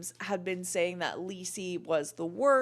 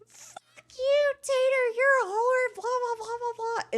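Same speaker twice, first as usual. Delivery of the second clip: very high, louder screechy mock rant; mocking shrieking Vape Boy; 5 dB louder than usual. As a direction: shriek the insults like a tantrum. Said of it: "And he is like".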